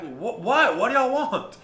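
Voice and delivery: loud, rising to high, impatient shout; retold yell back. Wife yelling back to her family, loud and impatient. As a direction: yell back from the other room. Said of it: "she finally yells back".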